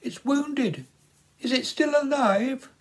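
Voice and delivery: put-on high, anxious girl's voice; a shy girl holding out a hurt bird; young girl voice. A narrator's reading. Young girl pleading with Philip, anxious high voice.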